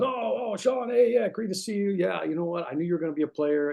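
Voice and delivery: 1 coach's greeting. Retold or imitated. Coach greets him, retold.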